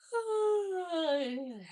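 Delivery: high, drawn-out falling sigh; long sigh. Real, not acted. Bored while waiting for others.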